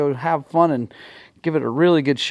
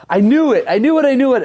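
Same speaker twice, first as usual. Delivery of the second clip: high, louder gloating repeats; triumphant; 8 semitones higher, 4 dB louder, faster than usual. Real, not acted. Rider crowing to viewers that he was right, triumphant high repeats. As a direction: crow it out like you called it. Triumphant after engine check.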